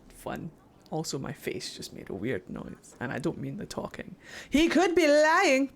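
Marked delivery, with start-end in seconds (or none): 4.5-5.7 mock outburst. Player butts in on the game character's theory, mock outburst. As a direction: burst out in exaggerated protest.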